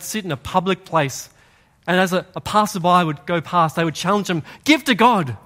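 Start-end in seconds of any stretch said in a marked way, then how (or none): 4.6-5.4 louder, higher calling out; beggar's yell. Acted. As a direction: challenge passersby with a shout. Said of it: "They would yell out".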